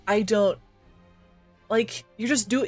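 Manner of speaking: usual speaking voice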